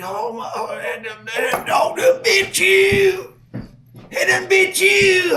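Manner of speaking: high, stammering panic building to a shout; imitated panicked yelling, very high and stretched; shell-shocked buddy. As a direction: stumble over the words, then holler them. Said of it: "he's shell-shocked more than I am".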